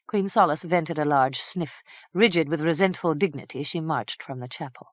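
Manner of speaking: ordinary delivery, nothing marked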